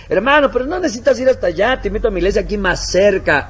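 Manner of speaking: high, loud coaxing invitation; mock persuasive churchgoer. Someone inviting a convert to a closer church, coaxing. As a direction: lure him away sweetly but loudly. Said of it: "viene alguien y dice".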